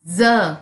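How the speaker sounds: ज buzzed as z; z sound. Shown as a demonstration. Teacher isolating the z sound in ज for trees. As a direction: give ज a voiced z. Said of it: "ज sound आ रही है इसकी".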